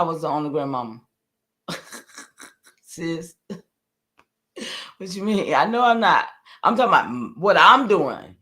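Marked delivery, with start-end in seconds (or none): none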